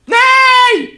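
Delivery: high loud no; anguished shout. Real, not acted. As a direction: cry out in dismay. Game failure.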